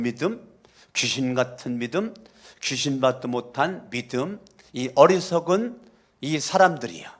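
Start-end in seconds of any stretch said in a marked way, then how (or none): none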